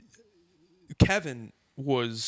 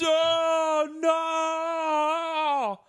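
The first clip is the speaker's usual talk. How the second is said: long, high wailed cry of dismay; hammed-up horrified shriek; imitated crowd scream; 3 dB louder than usual. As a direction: howl it high and stretch out the last word. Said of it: "like, screaming".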